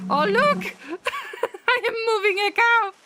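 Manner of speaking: high, fast, giggling delight; laughing. Real, not acted. Streamer delighted by a cow in the game, laughing. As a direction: laugh while pointing it out.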